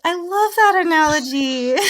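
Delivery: high, loud burst of delight. Real, not acted. Praising the co-host's analogy, delighted and loud. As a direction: gush with enthusiasm, pitched right up.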